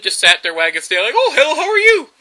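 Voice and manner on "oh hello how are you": high, loud, goofy doggy greeting; dim friendly dog. Retold or imitated. Stupid dog greeting the cat attacking him, high goofy cheer. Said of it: "the dog was too stupid".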